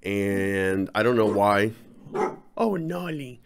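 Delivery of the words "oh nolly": talking to the dog. Real, not acted. Talking fondly to his dog.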